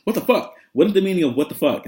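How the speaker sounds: high-pitched, rapid-fire kid imitation; super fast. Students asking the teacher what it means, super fast kid voice. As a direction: rattle it off like an excitable kid. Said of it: "they say it super fast".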